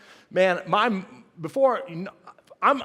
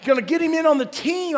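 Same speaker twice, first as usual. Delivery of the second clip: excited; 8 semitones higher, 4 dB louder than usual. Retold or imitated. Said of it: "I thought".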